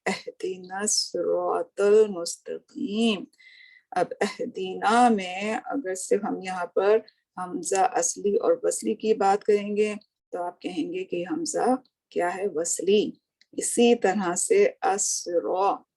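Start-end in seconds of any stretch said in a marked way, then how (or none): 0.1-3.3 अहदिना सिरातल मुस्तकीम recited slowly in Arabic style; teacher modelling the verse; slow recitation. Shown as a demonstration. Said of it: "फिर उसके बाद आते हैं". 14.7-15.8 असरा drawn out very slowly; teacher modelling असरा; slow recitation. Shown as a demonstration. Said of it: "असरातल में आप देखिए".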